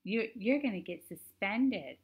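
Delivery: high, slow prissy mimicry; mock him in a prissy whine. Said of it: "made his voice real prissy".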